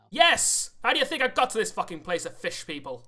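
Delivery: loud, high, exasperated outburst; indignant retort. Acted. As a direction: bellow it back as if insulted. Dwarf answers a sailor question indignantly.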